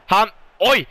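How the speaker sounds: high, loud, startled bursts; excited outcry. Real, not acted. Commentator watches Sanne Svensson's sudden chance, excited shouting. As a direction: shout each word as the chance explodes.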